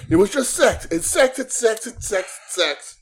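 high yell fading to mutter; yelling, then fading. Retold or imitated. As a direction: shout it, then let it sink. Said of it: "she just starts yelling".

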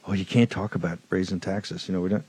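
quiet derisive impression of the critics; mocking mimicry. Mocking opponents' objection.

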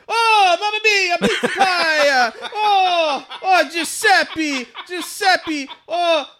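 loud, high, laughing mock Italian accent; jolly italian pizza chef. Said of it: "just being so racist towards Italians".

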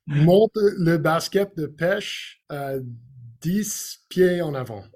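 put-on playful Quebecois accent, phrase by phrase; best Quebecois accent. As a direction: have a careful go at a Quebecois accent. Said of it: "in your best Quebecois accent".

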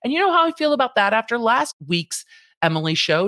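ordinary delivery

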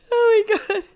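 tearful laugh, pitch jumping up; half laughing, half sobbing; laughing through tears. Real, not acted. Crying and laughing at once.

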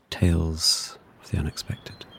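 slow, hushed spooky whisper. Acted. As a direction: breathe it like a ghost story. Spooky show title sign-off.

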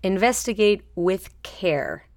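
"Investigate with care" said slowly, leaning on "with care"; slow and deliberate, stressing "with care". Shown as a demonstration. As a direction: linger on "with care". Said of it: "the with care part is important".

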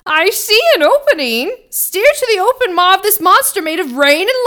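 high, loud excited call through a storm; shouting over wind. Acted. Herman shouts to the crew over the storm, high and excited. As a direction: yell it over the roaring gale. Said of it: "Herman's voice carrying over the wind".

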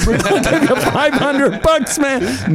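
high-pitched comic exclamation; mock incredulous outburst. Joking over the five hundred bucks bribe, high incredulous cry. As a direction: shoot the pitch way up in disbelief.

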